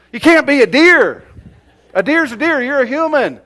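high, very loud mock protest; mock exasperation. Uncle telling nephew he can't be a deer, mock exasperation. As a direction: shout it like an incredulous correction.

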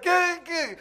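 loud, very high-pitched yelps; hammed-up panic for comic effect; mock scared. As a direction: squeal like someone cornered by a dog. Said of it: "I'm just scared of dogs".

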